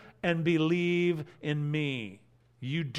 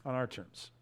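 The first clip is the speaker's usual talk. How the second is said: quiet echo; 4 semitones lower, 10 dB quieter than usual. Real, not acted. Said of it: "repeats the quote as his own verdict".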